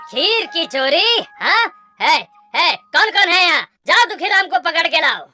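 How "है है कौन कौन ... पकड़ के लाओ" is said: loud, urgent royal order; commanding shout. Acted. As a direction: summon the guards to seize a culprit. King summons guards to seize culprit.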